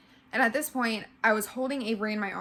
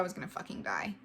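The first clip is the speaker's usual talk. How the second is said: hushed, fast whispered fear; 3 semitones lower, 8 dB quieter, faster than usual. Real, not acted. Woman recalling her fear of dying, whispering fast. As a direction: breathe it out in terror.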